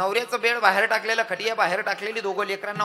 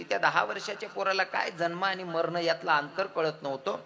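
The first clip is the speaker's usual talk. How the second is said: usual speaking voice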